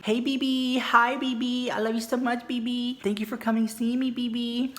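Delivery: put-on B B said high and cooing in place of baby; slurred B B.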